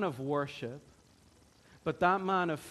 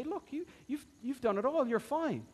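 mock smooth speech; 8 semitones higher than usual. Gideon calming Ephraim with lightly mocking flattery. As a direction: soothe them, tongue in cheek. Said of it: "a man who has smooth speech".

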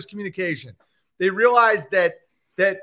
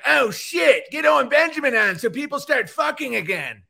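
mock panicked elites; 7 semitones higher than usual. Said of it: "they're like".

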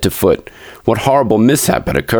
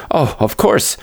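mildly hysterical, pitched up high; 8 semitones higher, slower than usual. A narrator's reading. Nurse answers Mister Button after her scream, hysterically. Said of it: "she cried hysterically".

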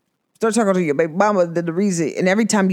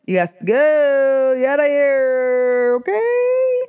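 high, slow diva send-off; mock regal goodbye, high and drawn out; sassy dismissal; 5 semitones higher, slower than usual. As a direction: brush him off like a queen. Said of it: "the Goddess [name] said".

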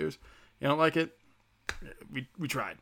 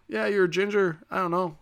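usual speaking voice

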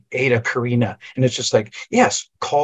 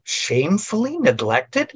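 higher, slow, disbelieving echo; slow, raised, scoffing question; mock incredulity; 7 semitones higher than usual. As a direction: repeat it as if it's absurd. Mocking the article's claim.

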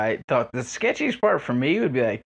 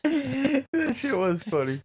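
laughing; 12 semitones higher, slower than usual. Real, not acted. Laughing at the memory.

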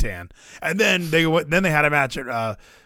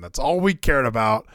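ordinary talk

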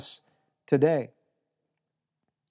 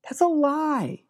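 much higher, slow, flat-out "That's a lie."; blunt denial; 9 semitones higher, slower than usual. Real, not acted. Pastor bluntly calling the parents' promise false, slow and high. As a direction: slam the claim down as false.

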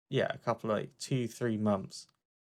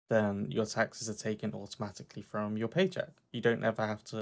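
ordinary talk, nothing marked

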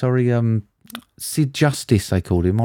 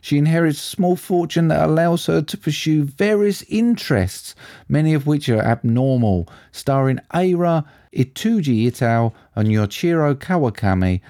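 higher-pitched, measured synopsis reading; film listing read-out; 7 semitones higher than usual. Acted. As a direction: read out the film blurb like a presenter, building to the title.

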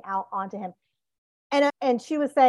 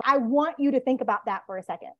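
usual speaking voice

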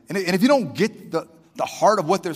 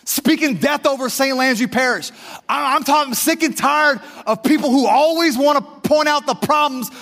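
rising, louder, fiery preacher build; preaching at full force; 9 semitones higher, 4 dB louder than usual. Real, not acted. Pastor venting to the church, full preaching shout. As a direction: preach it hard to the congregation.

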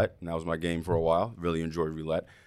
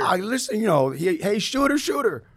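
hype craps caller; 13 semitones higher, 4 dB louder than usual. Retold or imitated. Calling out at craps table.

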